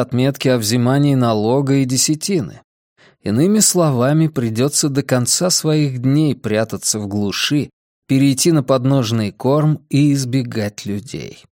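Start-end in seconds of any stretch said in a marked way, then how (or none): none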